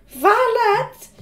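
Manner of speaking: high, loud summons; bossy order. A narrator's reading. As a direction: summon your servant imperiously. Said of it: "she ordered".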